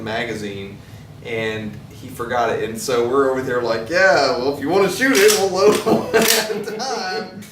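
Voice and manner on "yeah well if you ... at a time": high, loud mock-helpful voice, breaking into a laugh; joking retold offer. Friend retelling their offer to the guest, high joking voice. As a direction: play up the offer, pitched up and amused.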